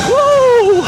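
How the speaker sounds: loud, high whoop of joy; excited whoop. Real, not acted. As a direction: let out a thrilled whoop. Cheering on straightaway.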